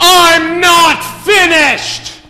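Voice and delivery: slow, high, very loud bellow; thundering judge cutting off the accused; indignant shout. Acted. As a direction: roar each word out, drawn long. Judge cuts off plea again.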